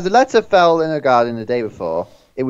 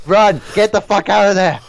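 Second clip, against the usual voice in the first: yelling; 7 semitones higher, 4 dB louder than usual. Real, not acted. Yelling at character on screen.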